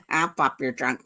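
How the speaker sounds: low, sarcastic under-the-breath mimicry; quietly mimicking the officer's demand, low and scornful; mocking echo.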